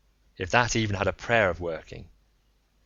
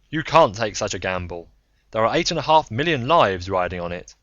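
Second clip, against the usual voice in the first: louder, urgent warning; Anderton's challenge; 2 semitones higher, 6 dB louder than usual. Acted. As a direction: press the danger hard.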